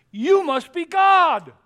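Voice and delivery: loud, high, slow adoring dog; hammed-up dog worship. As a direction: gush it like an eager dog. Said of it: "Dog says".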